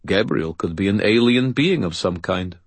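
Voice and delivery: ordinary delivery, nothing marked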